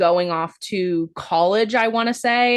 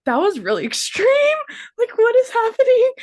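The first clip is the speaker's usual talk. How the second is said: much higher, laughing in disbelief; shocked disbelief; 3 dB louder than usual. Retold or imitated. Reliving her reaction to Michael's outburst, in high laughing disbelief. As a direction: squeal it out like you can't believe what you saw.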